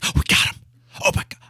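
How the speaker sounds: gleeful whisper trailing to nothing; fading mock whisper. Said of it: "they think".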